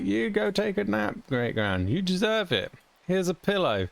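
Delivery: high, lightly voiced mock kindness; mock sweet send-off. Streamer jokingly voicing a send-off to great-grandmom. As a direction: play it as a fake gentle carer.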